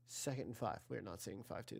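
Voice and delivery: dropping right down to a muttered correction; quiet self-correction. Real, not acted. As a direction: sheepishly take it back under your breath. Mutters his own correction.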